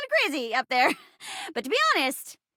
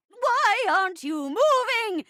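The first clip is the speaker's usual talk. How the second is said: high-pitched alarm building to a long cry; theatrical panic; 5 semitones higher than usual. Acted. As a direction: gasp and wail as betrayal dawns. Panicked at acted betrayal.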